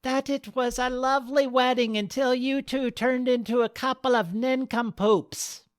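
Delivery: a sozzled wedding crasher butting in; tipsy old woman. Acted. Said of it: "A sozzled brim hat with a bulbous nose broke in".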